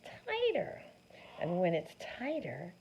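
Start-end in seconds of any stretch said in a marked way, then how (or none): 0.0-0.8 squeaky tight voice. Shown as a demonstration. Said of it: "When I stretch it, it gets".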